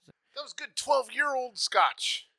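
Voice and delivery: slow, high, relishing the scotch; drawn out with pleasure; savoring. Real, not acted.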